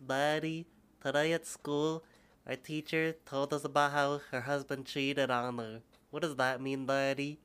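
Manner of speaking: lightly voiced little kid. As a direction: play a small kid asking dad. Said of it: "I sound like a little kid".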